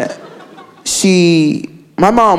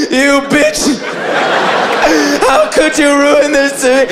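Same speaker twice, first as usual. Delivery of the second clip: mock crying; 6 semitones higher than usual. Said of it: "Like, crying".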